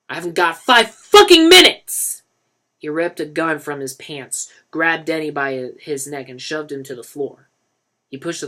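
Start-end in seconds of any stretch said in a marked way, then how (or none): 0.1-2.2 much higher, louder angry yell; furious yell. A narrator's reading. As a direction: explode with rage at him. Dealer explodes at Denny.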